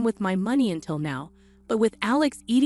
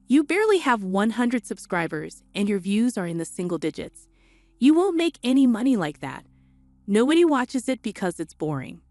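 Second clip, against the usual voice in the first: ordinary talk, nothing marked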